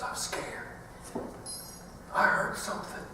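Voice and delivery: tiny frightened whisper; scared whisper. Retold or imitated. A frightened little boy whispering to dad at night. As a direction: breathe it, scared in the dark.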